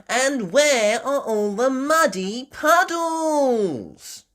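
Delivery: put-on high, slow, loud child-pig wail; Peppa. Peppa asking after the muddy puddles, high drawn-out voice.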